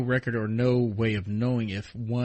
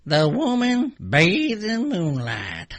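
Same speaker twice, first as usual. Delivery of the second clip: slow, higher and louder folksy Mister Haney read; 8 semitones higher, 6 dB louder, much slower than usual. Retold or imitated. Reading the ghost story to her friend as Mister Haney, hammed-up and slow. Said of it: "I can read this as Mister Haney".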